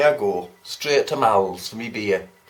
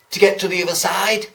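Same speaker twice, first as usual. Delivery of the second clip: quick, louder, higher punchline; eager punchline; 5 semitones higher, 6 dB louder, faster than usual. Retold or imitated. The bus bore gleefully delivering his punchline. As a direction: rush the punchline out, pleased with himself.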